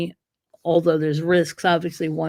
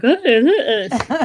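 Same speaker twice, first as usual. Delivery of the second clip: hesitant puppies; 6 semitones higher, 6 dB louder than usual. Retold or imitated. Puppies hesitating before growling mom.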